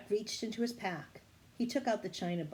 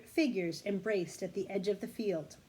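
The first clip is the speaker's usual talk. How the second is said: ordinary delivery, nothing marked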